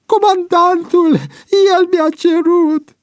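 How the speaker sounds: very high, loud, desperate excuse; tearful wail. A narrator's reading. Cornered Boian blaming the commander, wailing tearfully. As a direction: wail the blame onto someone else. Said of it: "izbucni Boian în plâns".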